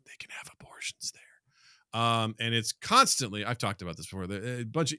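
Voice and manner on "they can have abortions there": whispered, dropping the touchy word. Real, not acted. Telling a friend about the clinic, hushed whisper. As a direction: hush it like a secret at the dinner table. Sensitive topic lowered.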